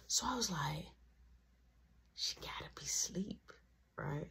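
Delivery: hushed unvoiced whisper; sneaky whisper to himself. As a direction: whisper so mom won't wake. Sneaking while mom sleeps.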